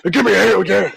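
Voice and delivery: shouting wrestler promo rant; barbarian. Acted. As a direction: bellow it like a brawler.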